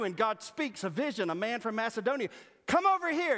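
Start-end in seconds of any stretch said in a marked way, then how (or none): 2.7-3.4 high, loud calling out; beckoning call. Acted. The man from Macedonia beckoning Paul, high and loud. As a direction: wave him across, eager.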